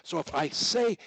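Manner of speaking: ordinary delivery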